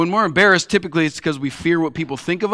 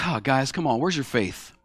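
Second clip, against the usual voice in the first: much softer, lower, gently chiding; calm reassurance; 4 semitones lower, 7 dB quieter than usual. Retold or imitated. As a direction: soothe them, unhurried and low. Jesus calmly answers frightened disciples.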